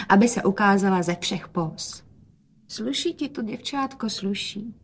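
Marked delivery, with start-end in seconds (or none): 2.7-4.7 soft, higher-pitched tender praise; quietly. A narrator's reading. Father compliments his girl, speaking quietly. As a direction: speak softly and gently, raised in pitch. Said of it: "hovořil otec tiše".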